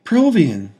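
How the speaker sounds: high, loud delighted cry of his name; joyful squeal. A narrator's reading. Woman joyfully greets her long-lost love. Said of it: "she squealed joyfully".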